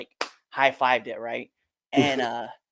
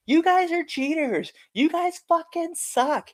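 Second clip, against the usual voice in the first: high-pitched angry fan yelling; yelling heckler; 13 semitones higher, 4 dB louder than usual. Retold or imitated. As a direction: imitate an irate woman heckling, pitched way up. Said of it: "she just yells at us".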